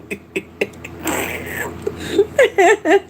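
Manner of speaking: long breathless high laugh; helpless laughter running out of air; real laughing. Real, not acted. Speaker cracked up by a viewer, laughing long. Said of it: "You got me laughing".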